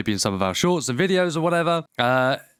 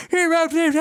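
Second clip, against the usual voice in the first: very high squealed cheer gibberish; hammed-up high girly cheer; college girl; 3 dB louder than usual. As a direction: shriek a garbled college team intro. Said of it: "Some of them are just like".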